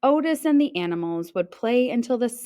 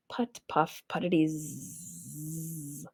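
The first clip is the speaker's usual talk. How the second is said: softer, slowing engine fading to a snore; sleepy tractor putter; 4 semitones lower, 9 dB quieter, slower than usual. A narrator's reading. Narrator voicing Otis dozing off, slowing and fading. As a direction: let the putter wind down into sleep.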